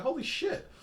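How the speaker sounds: high-pitched astonished exclamation; retold amazement. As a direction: relive the astonishment, pitch shooting up. Retelling his amazed reaction.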